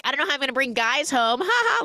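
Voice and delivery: high, quick quip with fake laugh; kinda joking. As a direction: joke nervously, ending in a high "ha ha". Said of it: "Like, kinda joking".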